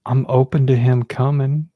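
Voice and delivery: ordinary talk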